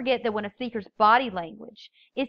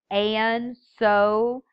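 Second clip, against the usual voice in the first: "and" and "so" stretched out; drawn-out filler words "and", "so"; vocalized pause; 5 dB louder than usual. Shown as a demonstration. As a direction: stall on a long "and", then a long "so". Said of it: "often use as a vocalized pause".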